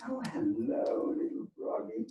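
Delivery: whispered sweet-talk to a frog; soft greeting to frog. Acted. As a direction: coo it softly at the little froggy.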